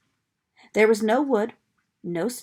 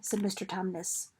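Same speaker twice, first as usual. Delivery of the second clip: usual speaking voice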